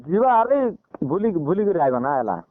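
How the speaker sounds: ordinary talk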